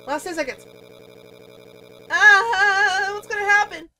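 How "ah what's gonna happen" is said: loud, high, drawn-out worry; anxious wail. Real, not acted. Player dreading the last ten seconds, anxious wail. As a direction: wail it in nervous suspense.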